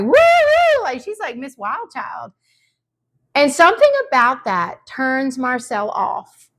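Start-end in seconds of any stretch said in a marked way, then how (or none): 0.0-0.8 high playful mock whoop; squealed party cheer; imitating a whoop. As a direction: whoop like a wild child. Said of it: "Jen is like".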